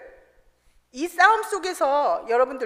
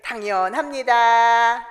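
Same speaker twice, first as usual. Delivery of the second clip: louder, slow and emphatic; firm declaration; 2 semitones lower, 6 dB louder, much slower than usual. Shown as a demonstration. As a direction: tell your neighbour firmly, one slow beat at a time. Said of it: "옆에 분한테". (옆 사람에게 힘주어 천천히 선언)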